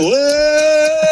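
a sudden cry as you go flying; startled whoa. Retold or imitated. Rider thrown off the spinning horse, startled high whoa.

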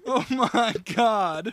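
high, slow, laughing sigh; real laughter stretching the words; helpless laughter. Real, not acted. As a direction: say it while cracking up.

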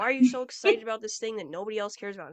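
loud, fast mocking put-down. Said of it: "you've probably had things like that said to you".

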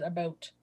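"about" said like "a-boat"; Canadian pronunciation. Retold or imitated. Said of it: "you said".

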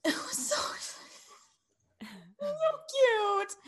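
breathy high whisper, giggling; whispered squeal of delight. Real, not acted. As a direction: gush in a whisper. Gushing over a cute scene.

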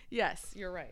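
ordinary delivery

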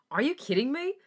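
high, loud incredulous question; scoffing disbelief. A narrator's reading. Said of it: "Felix scoffed in disbelief".

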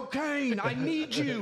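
high, pleading call to the dog; mock desperate call. Owner calling for the lost dog, high and pleading. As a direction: beg the lost dog to come home. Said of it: "calling the dog, mock plea".

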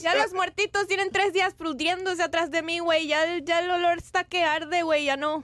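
lightly voiced Mexican reporter, güey on every line; casual Mexican accent, a touch panicked. Mocking a Mexican reporter stuck by bodies, in a Mexican accent. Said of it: "his Mexican accent".

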